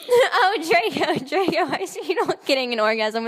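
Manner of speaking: hammed-up seductive voice, cracking up; mock breathy moaning. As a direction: overplay the lover's moan while laughing. Mock sex scene read aloud.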